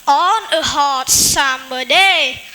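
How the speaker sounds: usual speaking voice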